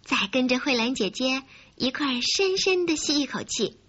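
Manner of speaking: plain reading voice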